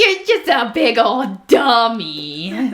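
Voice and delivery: slow, sing-song talking down; mock condescending. Scientist mocking critics, slow condescending baby talk. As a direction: talk down to them like a child.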